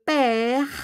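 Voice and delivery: "père" with a long open "eh"; accented open e. Shown as a demonstration. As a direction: draw out the vowel in "père". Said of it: "may accent po yung first na i".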